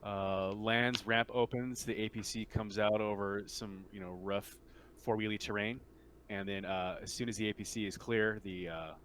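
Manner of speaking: usual speaking voice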